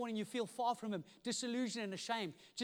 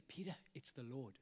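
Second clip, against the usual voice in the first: low, very soft voice; hushed realisation; 7 semitones lower, 10 dB quieter than usual. Acted. John tells Peter who is on shore, hushed. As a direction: say it quietly, with weight.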